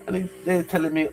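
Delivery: ordinary delivery, nothing marked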